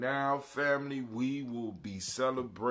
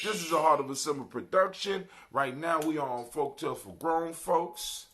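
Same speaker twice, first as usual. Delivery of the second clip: louder, higher announcer energy; big radio MC welcome; hype radio host; 6 semitones higher, 12 dB louder than usual. Acted. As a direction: pump up the crowd like a live DJ. Hyped host announcing the show.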